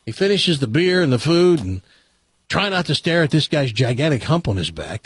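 usual speaking voice

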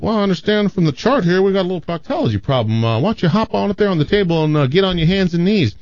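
a breezy country doctor giving orders; folksy Southern doctor. Acted. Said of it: "He's like".

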